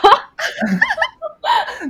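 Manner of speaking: squealing laugh, falling off; high burst of laughter. Real, not acted. Laughing at awkward intro.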